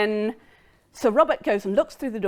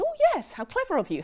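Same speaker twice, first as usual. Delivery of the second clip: mock delight; 13 semitones higher, 3 dB quieter, faster than usual. Mrs Cheveley feigns delight.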